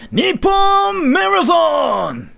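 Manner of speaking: high, drawn-out announcer shout; huge, slow, high-pitched title call; Nippon Marathon announcer. Shown as a demonstration. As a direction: belt out the title like a hyped game announcer. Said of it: "you really sound like the announcer from Nippon Marathon".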